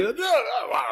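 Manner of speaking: high, quick no no no; hasty denial. Retold or imitated. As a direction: brush it off in a panic, pitched high. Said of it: "So he".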